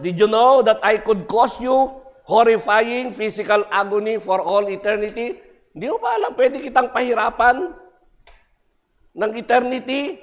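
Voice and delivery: high and loud, menacing devil; Satan threatening. Acted. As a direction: threaten him with endless agony. Satan threatens eternal agony.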